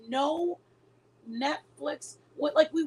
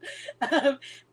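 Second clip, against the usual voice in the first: laugh. Real, not acted. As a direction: burst out laughing. Laughing at own joke.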